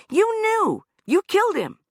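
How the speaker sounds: very high, loud accusation. Acted. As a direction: nail him with it. Anna accusing.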